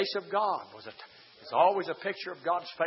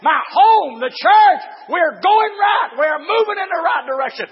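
shouted high preacher's whoop, drawn out; full-force preaching; 14 semitones higher, 17 dB louder, slower than usual. Acted. Preacher whooping to his congregation, shouted and high. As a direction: cry it out to the congregation.

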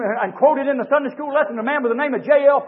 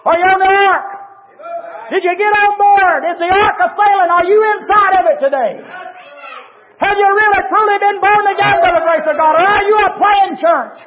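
raised, ringing sermon climax; fiery preaching; 9 semitones higher, 5 dB louder than usual. Acted. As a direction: build the sermon to a passionate peak. Preacher challenging congregation loudly.

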